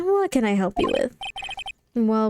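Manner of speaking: ordinary delivery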